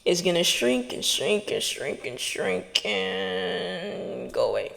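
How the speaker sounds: slow, dwindling to almost nothing; fading away. Shown as a demonstration. Speaker showing the negative voice shrinking, fading out.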